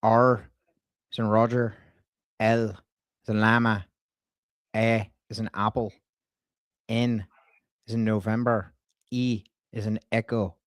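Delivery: spelling out a name in an Irish twang; put-on Irish brogue, letter by letter; Irish accent. Prank caller spelling a surname for a staffer, Irish accent. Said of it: "she really liked my Irish accent".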